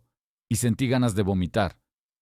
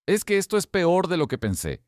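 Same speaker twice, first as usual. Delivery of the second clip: fake crying; 10 semitones higher than usual. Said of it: "con un llanto raro".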